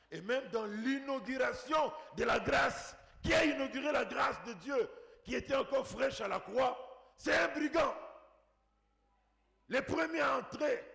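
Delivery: high, shouted proclamation; full-force preaching. Real, not acted. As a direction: proclaim it at the top of the voice.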